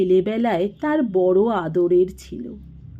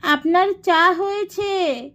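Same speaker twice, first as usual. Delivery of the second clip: much higher, put-on voice calling after him; household member calling out; 11 semitones higher than usual. A narrator's reading. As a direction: call out high to someone leaving. Servant voice in story dialogue. (উঁচু গলায় পিছু ডাকা)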